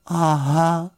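high, sheepish little bear; crestfallen. A narrator's reading. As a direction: let it droop sheepishly in a high small voice. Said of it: "řekl Paddington schlíple".

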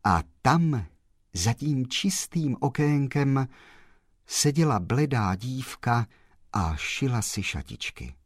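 usual speaking voice

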